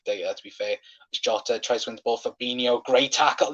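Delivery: ordinary talk, nothing marked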